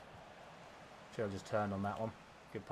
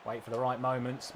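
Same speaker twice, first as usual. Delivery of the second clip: ordinary talk, nothing marked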